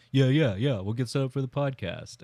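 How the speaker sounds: mock agreeable put-off; brush-off. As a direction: imitate someone putting you off. Acting out Caleb's hurried brush-off.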